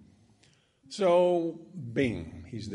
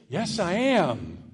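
insistent; 6 semitones higher, 8 dB louder, much slower than usual. Retold or imitated. Possessed friend insists.

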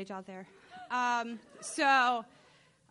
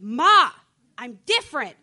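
loud insisting; 2 semitones higher, 16 dB louder than usual. Retold or imitated. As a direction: shout it out at last. Third try, blurting to mom.

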